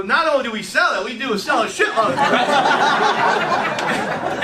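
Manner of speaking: laughing. Real, not acted. Laughing punchline.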